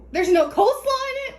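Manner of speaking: high, loud disbelieving question; shocked, voice jumping up; surprised. Real, not acted. Cook shocked stuffing missing.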